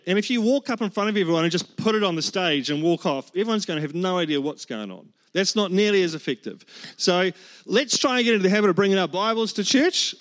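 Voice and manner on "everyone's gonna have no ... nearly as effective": usual speaking voice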